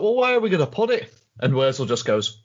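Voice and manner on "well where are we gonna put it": exasperated question. Retold or imitated. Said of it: "It's like".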